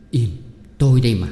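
usual speaking voice

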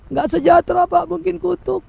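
complaining believer pleading with the pastor; whining congregant. Retold or imitated. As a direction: moan about the sickness, suspecting a curse. Congregant blames poverty on curse.

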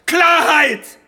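very high, very loud scream; screaming demand. Acted. Lawyer to the historians, screamed demand for clarity. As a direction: scream it, losing it.

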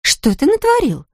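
high, suspicious woman. Acted. Blonde to her boyfriend, high and suspicious. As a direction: narrow the eyes and ask high. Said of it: "подозрительно взглянула".

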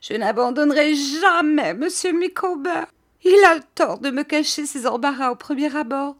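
high-pitched tearful lament; distraught wife, pitched well up; tearful protest. A narrator's reading. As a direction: plead through tears in a high, strained voice. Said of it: "elle se mit à pleurer en me répondant".

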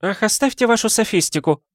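high, dismissive woman's voice; an exasperated lady waving off his logic; lady's voice. Acted. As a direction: brush him off in a high affected tone. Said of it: "ответствовал голос".

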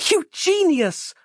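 very high, drawn-out elated yell; yelling back. A narrator's reading. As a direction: yell it back with delight, stretched out. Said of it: "Roz yelled back at him".

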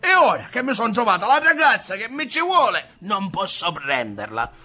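high, loud desperate rant; a young man raging at his lot; shouting. Acted. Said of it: "cominciò a gridare Ntoni". (sfogo gridato, alto e forte)

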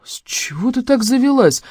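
high, hushed dismay; upset question. A narrator's reading. Brother asks sister about her outburst, upset. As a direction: ask it upset, with little voice. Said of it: "расстроенно спросил брат".

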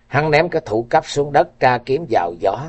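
plain reading voice

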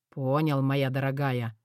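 low, softened husband's concession; grim irony. A narrator's reading. Husband yields to his wife's ultimatum, grimly amused. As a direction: concede darkly, with a grim smirk. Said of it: "мрачно усмехнулся он".

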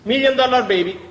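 ordinary talk, nothing marked

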